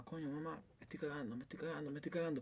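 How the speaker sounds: very soft, repeated plea to mom; hushed retold plea. Telling mom in the traffic jam, hushed urgent plea. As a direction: imitate a kid quietly pleading, barely voiced.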